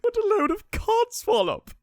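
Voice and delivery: high, sneering exclamation; scornful. Acted. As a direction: pour scorn on the act. Rival knight mocks performance.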